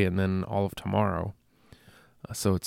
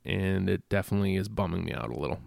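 ordinary talk

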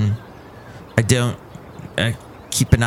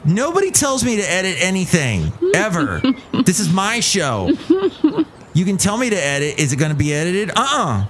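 raised, pompous show-owner voice; mock entitled boss; 7 semitones higher than usual. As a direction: play the indignant host, higher.

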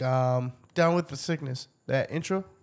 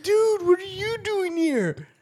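surprised quote; slower than usual. Retold or imitated. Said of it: "And I'm like".

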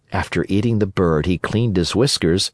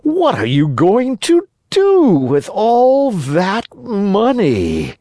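high, drawn-out sly fox; slow, high storybook fox; inquiring fox; 12 semitones higher, 4 dB louder, slower than usual. A narrator's reading. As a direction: probe slowly in a high, wheedling voice. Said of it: "inquired the fox".

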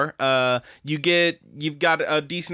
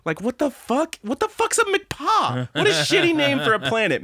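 much higher, disbelieving outburst; what-the-hell disbelief; incredulous; 13 semitones higher than usual. Real, not acted. Outraged at planet name.

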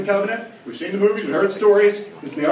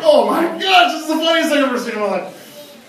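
much higher and louder, gushing with delight; giddy delight; 12 semitones higher, 6 dB louder than usual. Retold or imitated. Pastor imitating himself loving a silly movie, gushing. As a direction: squeal like it's the funniest film ever. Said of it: "I'm like".

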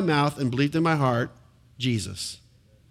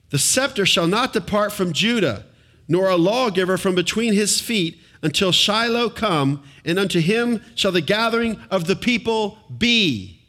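raised, measured reading of the verse; scripture reading; 4 semitones higher than usual. Acted. Preacher reading Genesis aloud to congregation, proclaiming.

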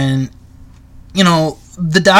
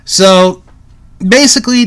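ordinary delivery

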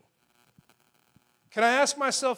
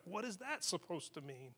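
much softer, lower puzzled murmur; puzzled aside; 3 semitones lower, 15 dB quieter than usual. Acted. Preacher voicing his confusion at an odd proverb, puzzled aside. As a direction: drop right down in mock bafflement. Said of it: "it leaves me scratching my head".

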